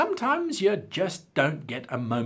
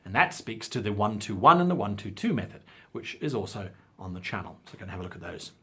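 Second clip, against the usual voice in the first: ordinary talk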